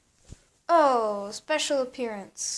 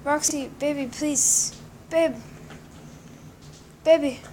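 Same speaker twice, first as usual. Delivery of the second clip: whiny pleading; 3 semitones higher than usual. Pretend. Begging cat to return.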